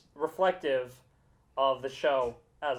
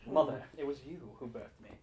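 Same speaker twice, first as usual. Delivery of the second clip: dramatic hushed voice; 3 semitones lower, 10 dB quieter than usual. Acted. Mock dramatic line about birth.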